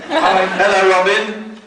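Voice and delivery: high, slow, drawn-out hello; Jerry Jacks greeting. Acted. Greeting Robin on speaker as Jerry Jacks, slow and high. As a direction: croon it like a soap star. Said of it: "it's Jerry Jacks".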